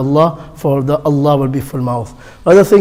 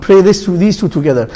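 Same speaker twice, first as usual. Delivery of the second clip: normal talking voice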